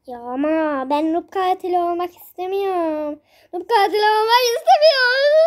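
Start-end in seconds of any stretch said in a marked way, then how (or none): 3.5-5.4 mock whine. Whines repeating the line.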